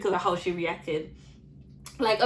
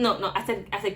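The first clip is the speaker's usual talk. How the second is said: ordinary talk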